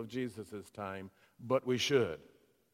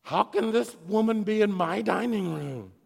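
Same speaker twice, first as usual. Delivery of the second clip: indignant Pharisee; 6 semitones higher, 6 dB louder than usual. Retold or imitated. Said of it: "react precisely as the Pharisee did".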